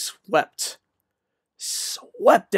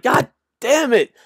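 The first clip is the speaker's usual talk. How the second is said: high-pitched, exasperated dog owner; angry scolding; 10 semitones higher, 5 dB louder than usual. Acted. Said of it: "you're still a little angry".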